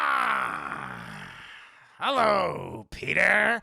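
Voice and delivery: loud drawn-out cry into a greeting; menacing goblin hello; Willem Dafoe. Acted. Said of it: "the Dafoe voice because I like it better".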